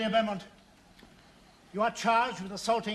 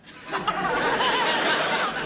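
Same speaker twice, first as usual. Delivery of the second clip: gloating laugh; 13 semitones higher, 4 dB louder than usual. Acted. Cruel courtroom laugh.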